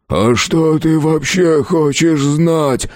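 high, drawn-out groan; moaning. A narrator's reading. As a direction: moan the question slowly, in distress. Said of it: "простонал Огрызок". (протяжный стон)

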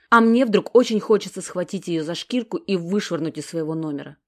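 plain reading voice

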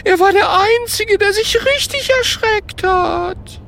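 very high, wailing falsetto lament; tearful ghost. Acted. Gerd misses his one victim, weeping. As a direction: whimper it up high, mourning someone lost. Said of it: "fängt es jämmerlich an zu weinen".

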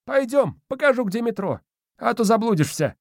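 put-on high, scoffing old spirit; squeaky old man, dismissive; domovoi voice. A narrator's reading.

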